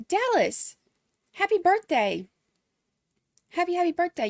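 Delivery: high, gushing happy birthday; sing-song high greeting, not sung; excited birthday wish. Real, not acted. Streamer greets the birthday chatter, high and gushing.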